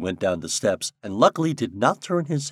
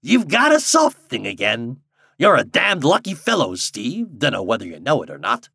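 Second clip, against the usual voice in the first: lightly voiced old wharf hand, louder; old Ben; 4 semitones higher, 4 dB louder than usual. A narrator's reading. Old Ben teasing Steve about his luck, folksy old man. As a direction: tease him like a folksy old-timer. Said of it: "shifting his quid of tobacco".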